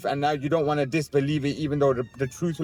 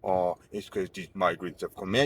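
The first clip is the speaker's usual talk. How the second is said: mocking complainer; 8 semitones lower, 3 dB quieter than usual. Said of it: "talking about".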